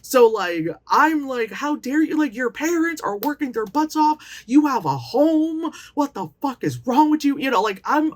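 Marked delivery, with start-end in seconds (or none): 1.5-7.4 put-on higher, stern older-cousin telling-off; older cousin scolding. Older cousin scolding the ungrateful girl, stern and higher. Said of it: "I'm doing, like, the older bitch".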